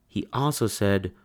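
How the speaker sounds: ordinary delivery, nothing marked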